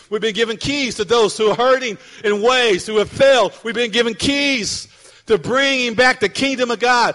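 loud, rising sermon delivery; preaching. Acted. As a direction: preach it out to the congregation. Preaching builds to a climax.

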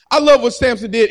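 usual speaking voice

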